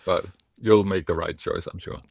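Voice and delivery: ordinary talk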